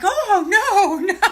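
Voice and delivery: high, laughing oh no; laughing mock dismissal. Mocking a commenter's reaction.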